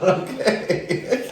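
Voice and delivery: breathless laughter; laughing. Real, not acted. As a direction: laugh through the word. Laughing at retching friend.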